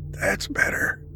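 soft breathy relief; relieved whisper. Acted. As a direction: sigh it out, relieved. Relief after morphine.

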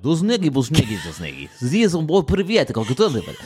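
imitating Russian tourists' chatter in a rough Russian accent; a hint of Russian accent, gibberish Russian chatter; mock Russian. Imitating Russian tourists talking to each other, mock Russian accent. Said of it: "the whole time talking like".

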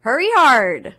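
half-hearted curling call; curling skip yelling. Pretend. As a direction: call it across the ice, but hold back a little. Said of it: "give me, Brittany Greenslade, please, a big hurry, hurry hard".

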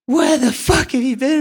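high, panicked mock yell of a shaken young lad; high, overwrought imitation of a rattled friend; frantic distressed mate. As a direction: imitate the lad's frantic relief, pitched right up.

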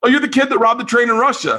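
high, fast, excited; older man at a bar recognizes him, high and fast; excited recognition. Retold or imitated. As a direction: blurt it out in sudden delight.

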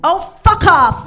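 loud, high yell with a Kentucky twang; shouted Kentucky accent. Retold or imitated. Cabin owner tells off a mountain lion, Kentucky-accented shout. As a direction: bellow it as a fed-up Kentucky woman. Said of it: "my very bad Kentucky accent".

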